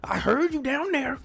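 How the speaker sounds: high, strained mock rant at Will; furious cousin confronting Will, high and raw; Carlton going off. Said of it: "going off on Will".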